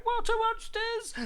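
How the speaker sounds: very high, loud squeal; storybook shriek of alarm; alarmed cry. A narrator's reading. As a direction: squeal it out as a frightened dinosaur. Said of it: "one cried".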